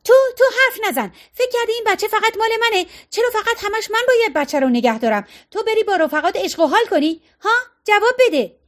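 high-pitched, breakneck tirade; furious shrieking. A narrator's reading. Fiona turning on Jessie over the baby, shrieking. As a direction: lash out in a high screech, demanding an answer. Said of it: "فیونا جیغ کشان چرخید به سمت او".

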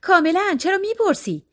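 high-pitched, proud brush-off. A narrator's reading. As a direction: lift the pitch, proudly denying any trouble. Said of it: "او مغرورانه به روبرو خیره شد و پرسید". (مغرورانه، با صدای زیر)

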